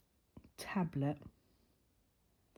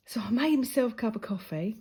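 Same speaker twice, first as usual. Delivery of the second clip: normal talking voice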